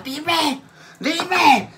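high, sharp mock of mom yelling; mother demanding. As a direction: imitate mom barking her question. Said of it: "hagan de cuenta que mi mamá".

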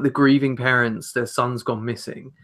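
ordinary talk, nothing marked

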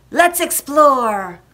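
loud, slow, drawn-out call; rally the crew to set off; adventurous cry. A narrator's reading. Jack urges shipmates across the island, rallying cry. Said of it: "he cried".

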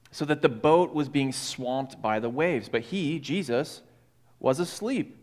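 measured Bible reading voice, unhurried; scripture reading. Acted. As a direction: read the passage like a storyteller in the pulpit. Reads the gospel passage aloud.

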